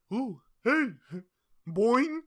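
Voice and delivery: loud, high goofy voice; silly mock voice. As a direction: ham up a random streamer. Mocking random video voices.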